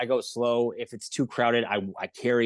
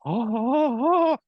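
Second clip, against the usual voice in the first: mock scream; 10 semitones higher, 5 dB louder, slower than usual. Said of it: "and I just went".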